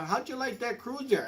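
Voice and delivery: lightly voiced wife asking. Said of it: "she says".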